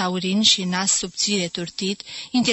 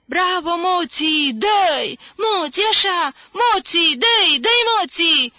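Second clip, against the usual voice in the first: high, loud cheering with drawn-out words; delirious fan screaming encouragement; frantic hoarse yelling; 9 semitones higher, 6 dB louder, slower than usual. A narrator's reading. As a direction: cheer the boxer on tirelessly, yelling. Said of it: "cu glas răgușit, țipa frenetic, neostenit".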